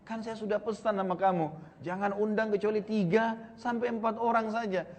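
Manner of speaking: mock scolding. Wife scolds husband over the invitation.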